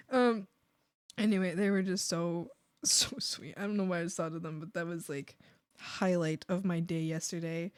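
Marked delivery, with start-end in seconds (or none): none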